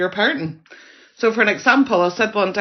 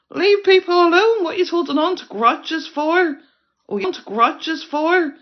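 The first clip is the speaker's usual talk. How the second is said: indignant shout; 9 semitones higher than usual. Real, not acted. Vlogger telling viewers to leave Liz alone, indignant shout. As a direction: shout them down in Liz's defence.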